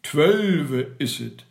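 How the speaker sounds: loud, slow announcement; satisfied, stretched-out call; little gentlemen confirming. A narrator's reading. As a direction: draw it out with a falling close. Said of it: "sagten all die kleinen Herren".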